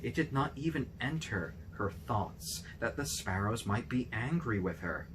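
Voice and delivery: ordinary delivery, nothing marked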